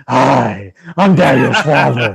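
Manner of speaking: low, slow-burn superhero growl parody. Retold or imitated. Podcaster mimicking the dad's sudden Batman voice, low impression. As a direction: drop into a mock low masked-vigilante voice.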